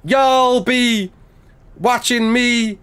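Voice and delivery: high, drawn-out mock American drawl; bad American accent. Shown as a demonstration. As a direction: ham up a Southern American accent, phrase by phrase. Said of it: "I hate doing American accents".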